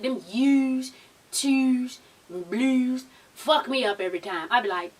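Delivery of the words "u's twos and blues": imitated Baltimore vowels on U's, twos and blues; Baltimore accent. Shown as a demonstration. Vlogger teasing her Baltimore sweeties, mock Baltimore accent. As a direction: draw out the Baltimore "oo" in each word. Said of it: "but child, that accent".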